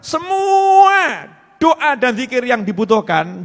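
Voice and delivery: slow, loud, high preaching shout; fiery sermon cry at full force. Real, not acted. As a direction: bellow it to the hall.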